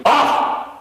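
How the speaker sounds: high sharp yell of "Off!"; scream off. Shown as a demonstration. Trainer shows the child how to yell, sharp high yell. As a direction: yell it to stop the bite. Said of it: "I want you to scream".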